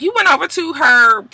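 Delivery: questioning, climbing very high at the end; irritated disbelief. Retold or imitated. Tommy questioning her friend about the shoot, irritated. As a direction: lift the last words in offended disbelief. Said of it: "Tommy is irritated".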